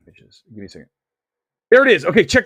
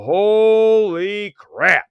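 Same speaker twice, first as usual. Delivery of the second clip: slow, drawn-out "Holy crap", a little louder; awestruck disbelief; 4 semitones higher, 8 dB louder, much slower than usual. Retold or imitated. Viewer reacting to the fossil video, slow awestruck disbelief. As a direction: let it sink in, stunned. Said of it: "make you go".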